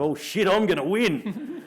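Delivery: a stunned laugh as victory dawns; laughing realisation. Retold or imitated. Rider realises mid-sprint he will win, laughing surprise.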